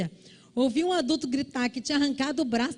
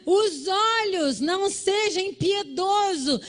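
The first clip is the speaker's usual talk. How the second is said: high, loud, urgent sermon; fervent high preaching; preaching at full force; 8 semitones higher, 4 dB louder than usual. Acted. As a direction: drive the lesson home to the church.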